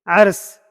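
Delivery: عرس said firmly, opening on ayn, ending in small seen; teacher's model pronunciation. Shown as a demonstration. Teacher spells out the letters, then models عرس clearly. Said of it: "عین، را اور چھوٹی سین".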